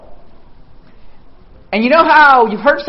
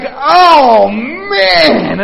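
shocked groan; 6 semitones higher, 6 dB louder than usual. Retold or imitated. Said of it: "I was just like".

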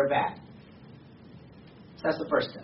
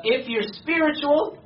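high, slow mock-pious quoting; 8 semitones higher, slower than usual. Preacher parodying the myth about spiritual people, mock pious. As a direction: draw it out high, quoting a myth you hate.

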